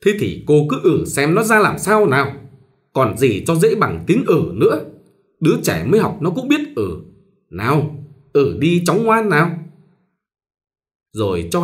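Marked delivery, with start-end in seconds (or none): 0.0-9.6 higher, teasing push to say yes; urging go-between. Acted. Go-between teasing the bride to just say yes, mock coaxing. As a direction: coax her like coaxing a child. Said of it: "Tài dục".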